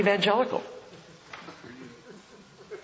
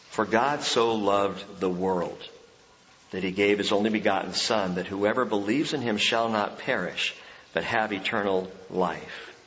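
hushed reverent recital; scripture reading; 10 semitones lower, 4 dB quieter than usual. Acted.

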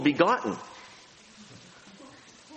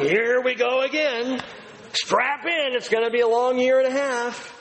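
weary mock sigh; 8 semitones higher than usual. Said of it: "you're looking at it going".

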